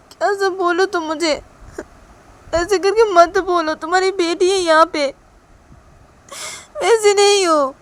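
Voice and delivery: high, loud, wounded wail; tearful protest. Acted. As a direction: protest as if bursting into tears. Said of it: "Ruhi जब ऐसे बोलती है और रूही रोने लगती है". (रोते हुए ऊँची आवाज़ में विरोध)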